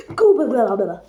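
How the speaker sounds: ordinary delivery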